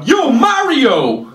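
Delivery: slow, drawn-out call of Yo, Mario; hammed-up street shout at a mustache; mock heckler call. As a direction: drag it out like a stranger yelling across the street. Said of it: "there's my favorite one".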